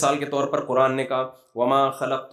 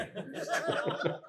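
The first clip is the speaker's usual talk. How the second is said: own laugh; 11 dB quieter than usual. Real, not acted.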